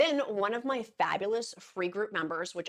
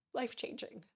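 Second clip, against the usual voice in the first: tearful whisper; 4 semitones higher, 7 dB quieter than usual. Real, not acted. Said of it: "I need to stop crying".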